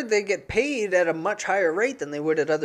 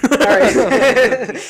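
said through real laughter, louder and higher; laughing; 8 semitones higher, 8 dB louder than usual. Real, not acted. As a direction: burst out laughing on the words. Laughs off a joke.

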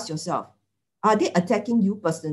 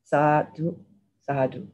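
merit-sharing prayer; 2 semitones lower than usual. Acted. Nun leading the group in sharing merits, solemn prayer. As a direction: recite each phrase as a blessing, with pauses.

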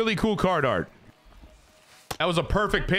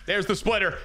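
normal talking voice

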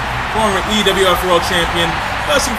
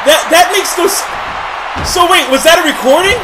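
surprised; 8 semitones higher than usual. Real, not acted. Commentator stunned by surprise attack.

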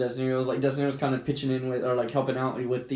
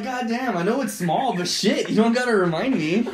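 amused mock annoyance; 6 semitones higher, 3 dB louder than usual. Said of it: "I was like".

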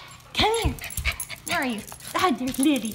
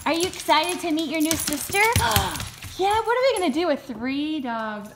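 ordinary delivery

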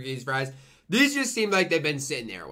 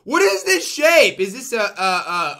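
baffled; 7 semitones higher, 8 dB louder, slower than usual. Real, not acted. Puzzling over fish shape.

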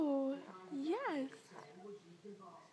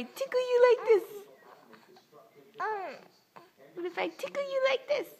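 playful tickle voice; 7 semitones higher, faster than usual. Real, not acted.

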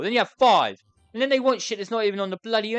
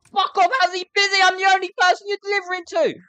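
loud, higher exasperated yell; furious rant; angry shouting; 10 semitones higher, 9 dB louder than usual. Real, not acted. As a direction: shout at the delivery man. Angry at busy delivery man.

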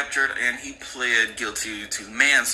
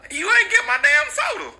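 much higher, louder mock mom yell; 5 dB louder than usual. Mother yells at son about her soda, high mock yell. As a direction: shriek at the kid over the soda. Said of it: "she started yelling at him".

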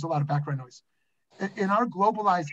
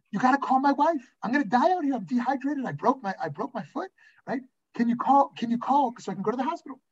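higher-pitched, urgent acted begging; retold frantic appeal; desperate plea; 7 semitones higher than usual. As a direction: beg a passer-by for help, hammed up. Retold plea to passing cyclist.

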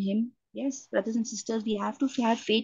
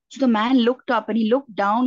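usual speaking voice

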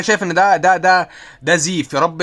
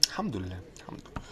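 whisper; 9 semitones lower, 18 dB quieter, faster than usual. Real, not acted. Muttered thanks under his breath.

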